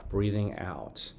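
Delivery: slow, soft and calm; a calm teacher modelling the out-breath; calm demo. Shown as a demonstration. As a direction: ease the words out as you exhale. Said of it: "in a nice, calm".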